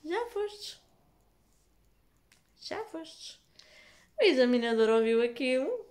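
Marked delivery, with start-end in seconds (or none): 0.0-0.8 replaying her dismayed words in a higher, put-on voice; a higher, comic cry of "Já fostes"; mock dismay, higher. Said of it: "a primeira palavra que eu disse assim foi". 2.6-3.4 a hushed, higher "Já fostes" said to herself; almost under her breath, "Já fostes" in dismay; softer mock dismay. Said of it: "a primeira palavra que eu disse assim foi".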